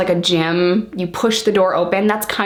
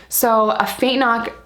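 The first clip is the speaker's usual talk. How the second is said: usual speaking voice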